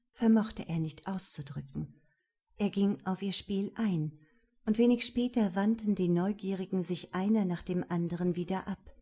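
ordinary delivery, nothing marked

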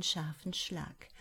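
ordinary delivery

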